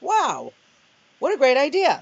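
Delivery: high, loud burst of enthusiasm; excited delight. Retold or imitated. Author hears a book club will read her book, excited delight.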